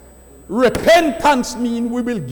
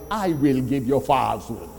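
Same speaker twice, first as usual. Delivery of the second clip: deep, much lower, softer pronouncement; voice of God; 9 semitones lower, 5 dB quieter than usual. Retold or imitated. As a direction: deliver God's sentence low and grave. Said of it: "God said".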